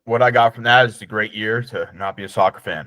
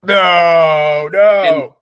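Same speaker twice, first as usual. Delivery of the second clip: loud, higher cry of disbelief; shocked disbelief; 6 semitones higher, 7 dB louder than usual. Real, not acted. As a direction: recoil at the news, louder and higher. Reacting to Bonnie Blue news.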